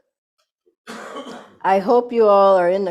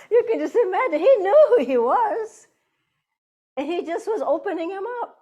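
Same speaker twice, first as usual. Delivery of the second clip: much higher, laughing through the words; laughing delight; 11 semitones higher than usual. Real, not acted. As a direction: giggle with amused admiration. Delighted at the Master's trick.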